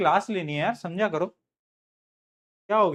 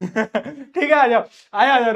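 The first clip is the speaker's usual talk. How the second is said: ordinary delivery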